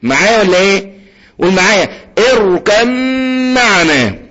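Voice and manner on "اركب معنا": اركب معنا with no ب, a long hummed م; باء merged into ميم. Shown as a demonstration. Teacher leads students again, باء merged into ميم. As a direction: skip the ب of اركب معنا and hold the م. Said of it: "الباء ما تجيبلاش اي سيرة".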